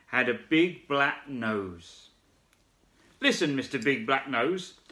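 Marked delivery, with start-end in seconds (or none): 3.2-4.8 high calling shout; frog shouting. Acted. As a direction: call out to the koala. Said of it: "shouted the big wide-mouthed frog".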